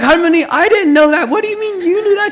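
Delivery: very loud and high, laughing in disbelief; shocked. Retold or imitated. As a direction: blurt out your total shock. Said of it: "I was like".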